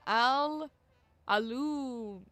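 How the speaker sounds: "aluminum" garbled slowly as a-loo-min-um-ee-um; botched British ALUMINIUM. Shown as a demonstration. Streamer fumbling the British word, drawn-out garbled ALUMINUM. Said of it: "They say it like it's".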